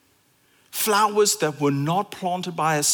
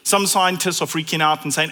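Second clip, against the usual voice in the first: ordinary delivery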